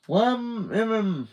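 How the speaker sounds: high muffled words behind a gag; muffled through gag. A narrator's reading. Gagged Carmen mumbling at her brother. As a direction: try to talk through a gag. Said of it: "Carmen said".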